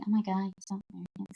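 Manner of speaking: mock the one who sounds stuck up; mock snooty jab. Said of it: "It's just like".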